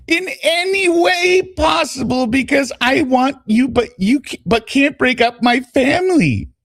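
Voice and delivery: high-pitched, pleading woman's voice; Corey. Acted. Explaining to her lover why goodbye, high put-on voice. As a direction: lift the pitch and read her excuses dramatically. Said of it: "so this is Corey again".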